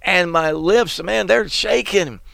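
high, strained scared voice; frightened prophet. Retold or imitated. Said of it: "He said".

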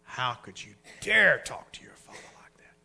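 hushed, breathy rebuke; mother's whispered scolding. Retold or imitated. Mother hushes son for backtalking his father. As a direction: whisper the shame into him. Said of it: "It was their mother who said".

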